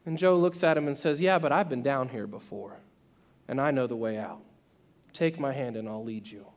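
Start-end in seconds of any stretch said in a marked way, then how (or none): 1.2-6.5 low, softened voice of a steady friend; calm reassurance. Retold or imitated. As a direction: reassure gently, sinking lower and quieter. Friend calmly reassures trapped man.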